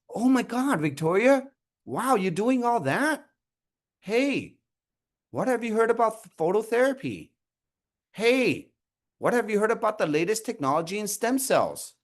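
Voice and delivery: high-pitched, impressed enthusiasm; emphatic interest. Shown as a demonstration. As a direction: react amazed, then pitch it eagerly. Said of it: "putting that emphasis on that conversation".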